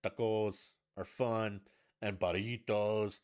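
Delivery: put-on slow, loud Spanish accent on "Tacos" and "burritos"; hammed-up Spanish-accented food words; mock Spanish accent. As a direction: switch into an exaggerated Spanish accent. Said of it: "Where's this accent coming from?".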